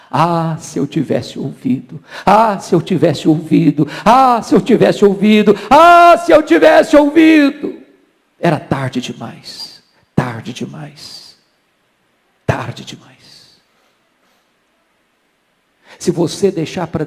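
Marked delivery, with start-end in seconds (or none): 0.1-7.8 desperate cry. A narrator's reading. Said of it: "saiu gritando, com lágrimas nos olhos". 8.4-13.6 very slow, low, sinking into a whisper; somber fading refrain. A narrator's reading. Tragic end of the story, fading.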